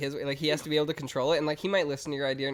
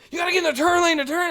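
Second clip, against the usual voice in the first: high, loud panicked yell at the driver; a driving instructor losing it at a student; instructor freaking out; 9 dB louder than usual. Retold or imitated. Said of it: "freaked out in the middle".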